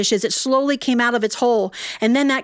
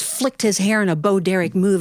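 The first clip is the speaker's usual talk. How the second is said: ordinary talk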